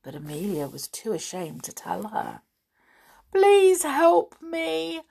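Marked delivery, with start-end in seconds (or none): 3.3-5.0 high, very slow pleading cry; begging. A narrator's reading. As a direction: beg for help, drawn out. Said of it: "begged the big doll".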